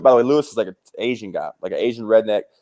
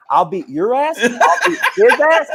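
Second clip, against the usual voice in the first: laughing disbelief; 11 semitones higher than usual. Retold or imitated. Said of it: "And I'm like".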